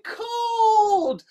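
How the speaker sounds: high, drawn-out cry; scream demo. Shown as a demonstration. Telling kids what to say, high drawn-out yell. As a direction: stretch it out high like a crowd yell. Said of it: "scream as loud as you can".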